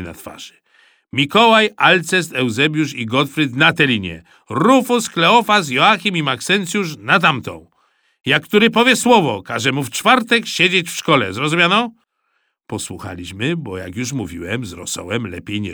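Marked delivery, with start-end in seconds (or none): 1.1-11.9 loud, raised-pitch commanding voice; stern orders. A narrator's reading. Supervisor sorting boys into teams, barking orders. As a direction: order the children about loudly. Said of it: "krzyknął Rosół, który był cały czerwony".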